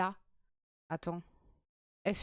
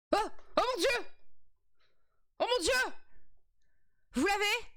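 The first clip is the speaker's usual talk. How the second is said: high, excited cries; thrilled; 9 semitones higher than usual. Real, not acted. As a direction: burst out with joy at the answer. Solving the riddle, thrilled. (cris de joie très aigus)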